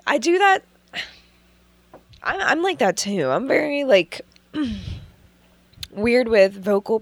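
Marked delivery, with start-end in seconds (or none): 0.0-5.9 speaking just after choking on water; recovering from choking on water; choking on a drink. Real, not acted. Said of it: "I'm choking on my water".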